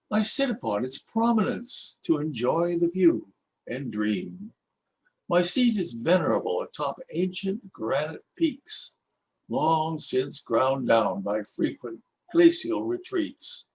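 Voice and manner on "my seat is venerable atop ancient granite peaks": ordinary delivery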